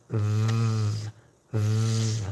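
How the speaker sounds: long, sustained buzzing "v"; isolated v sound. Shown as a demonstration. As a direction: hold the "v" as a drawn-out buzz, lip almost bitten. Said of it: "pronounce the v sound".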